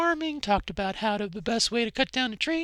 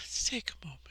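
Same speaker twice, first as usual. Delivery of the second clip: soft unvoiced whisper; 7 semitones lower, 10 dB quieter, faster than usual. Real, not acted. Pausing with viewers after getting emotional, in a whisper. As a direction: breathe it out in a whisper.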